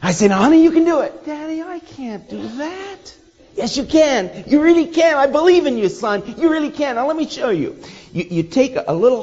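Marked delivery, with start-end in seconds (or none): 0.3-1.2 coaxing, much higher, louder; encouraging father. Retold or imitated. Father coaching son in story. 1.2-3.1 put-on high, soft little-kid voice; a small boy refusing; little boy whining. Son voiced in story. 3.6-7.8 encouraging father. Retold or imitated. Father coaching son.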